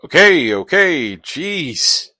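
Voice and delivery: loud, high, exasperated protest; exasperated giving in. Acted. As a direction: give in loudly, fed up with nagging. Nagged to go change.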